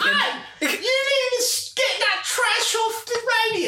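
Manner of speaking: put-on very high, slow, posh British accent, Mary Poppins style; British lady impression. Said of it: "there's a British lady that drove by and was just like".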